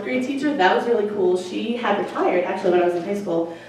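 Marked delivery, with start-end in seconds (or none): none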